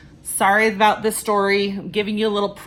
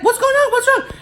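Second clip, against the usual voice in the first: much higher, startled questions; alarmed neighbor; 4 dB louder than usual. Retold or imitated. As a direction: ask in sudden alarm. Neighbor arrives at commotion.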